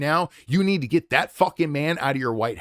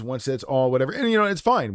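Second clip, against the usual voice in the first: ordinary delivery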